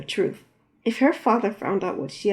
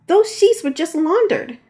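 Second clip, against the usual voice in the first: high, slow indignant scold; huffy and offended; 9 semitones higher, 6 dB louder than usual. Acted.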